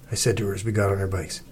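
plain reading voice

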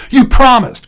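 put-on high, loud childish wail; crying out. A narrator's reading. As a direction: cry it out as an accusing kid. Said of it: "cried Sam".

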